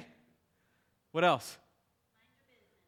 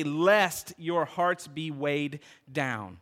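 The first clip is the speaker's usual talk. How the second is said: very slow weighty lest; slow solemn reading; 2 semitones lower, much slower than usual. Acted. Pastor reads the warning slowly to the church. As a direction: linger on every word.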